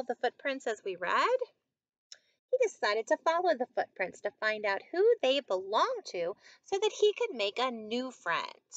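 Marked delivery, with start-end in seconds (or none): 2.5-8.7 measured picture-book narration, fuller voice than the chat; story reading to children. A narrator's reading. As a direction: read it out clearly for young listeners.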